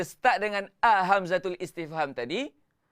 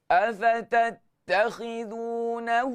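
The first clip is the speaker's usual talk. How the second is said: slow, chanted tilawah with long held vowels; melodic Quran recitation; 4 semitones higher, slower than usual. Shown as a demonstration. Teacher chanting the verse for his online class, melodic recitation. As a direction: recite it as a teacher modelling for students.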